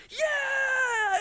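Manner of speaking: high shrieking crowd yell; crowd scream. Retold or imitated. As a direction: scream it like a whole room cheering.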